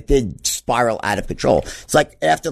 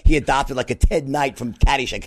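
ordinary delivery

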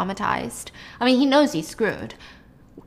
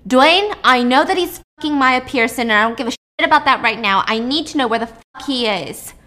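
loud, irate demand, easing at the end; screaming into phone; 3 semitones higher, 6 dB louder than usual. Acted. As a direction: yell it down the phone at the assistant. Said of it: "Nina screams into the phone".

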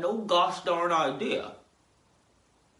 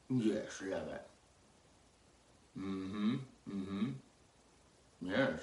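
much lower, soft pet coo; 8 semitones lower, 9 dB quieter, slower than usual. Pretend. Owner cooing softly to cat Lemon.

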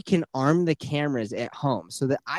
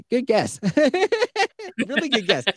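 laughing; 10 semitones higher than usual. Real, not acted. Laughing at friend's dog guess.

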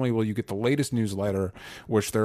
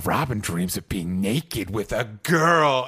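slow, lightly voiced Riddler taunt; mock Riddler, stretched out; Riddler quote; much slower than usual. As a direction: drawl it out like a sly villain. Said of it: "Riddler's like".

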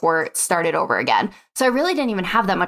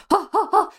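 high excited stammering huffs; broken sentences; 11 semitones higher, faster than usual. Retold or imitated. Spazzing out over the TikTok idea, high stammering huffs. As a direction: sputter out breathless half-words. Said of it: "talking in broken sentences".

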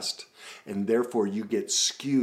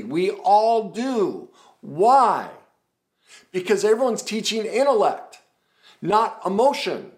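full-force preaching; 13 semitones higher, 11 dB louder than usual. Acted.

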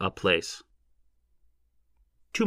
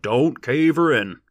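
slow, loud, higher warning bellow; 5 semitones higher, 6 dB louder, slower than usual. A narrator's reading. Bearded digger warning crowd at the pit, bellowing. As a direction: bellow it out slowly, pitch up. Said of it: "he bellowed".

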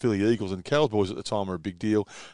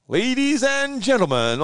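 high, slow, booming circus announcer; 13 semitones higher, 3 dB louder, slower than usual. Retold or imitated. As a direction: announce it like a big circus ringmaster. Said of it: "like this big, you know, circus sort of announcement".

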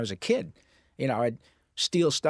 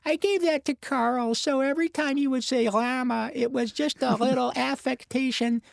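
Carl Wheezer voice; 6 semitones higher than usual. Shown as a demonstration. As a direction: talk like the wheezy nerdy kid. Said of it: "I could do it all day".

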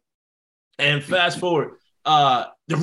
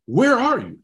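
higher, insistent mock agent; exasperated demand; 7 semitones higher than usual. Agent hunting down his client, exasperated. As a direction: demand to know, pitched up.

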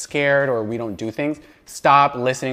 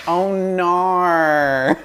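high, drawn-out Aussie "oh nar"; Australian accent; 6 semitones higher, 4 dB louder than usual. Shown as a demonstration. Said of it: "It's my favorite word".

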